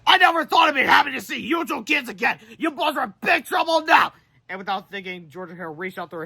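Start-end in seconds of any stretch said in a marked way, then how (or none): 0.1-4.1 much higher, loud, triumphant; gloating shout. Acted. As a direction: yell it with nasty glee. Principal gloating at boys.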